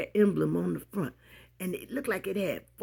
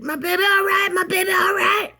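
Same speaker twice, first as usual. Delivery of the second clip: much higher, loud worried cry; worried daddy; 9 dB louder than usual. Retold or imitated. Father jumping out of car, shouting for his girl. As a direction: call out in alarm for his child. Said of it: "ran and said".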